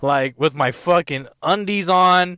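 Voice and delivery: loud exasperated rant about being single; exasperated outburst. Real, not acted. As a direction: rant it out, fed up with himself.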